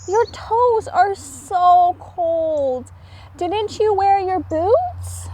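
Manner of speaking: high tender big brother; caring brother. A narrator's reading. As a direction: soothe your little brother.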